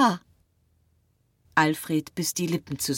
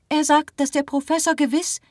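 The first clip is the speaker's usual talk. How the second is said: much higher, urgent woman's voice; Marie interjecting; 12 semitones higher, 6 dB louder than usual. A narrator's reading. Marie breaks in to reassure, high and urgent. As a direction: break in high and quick, insistently.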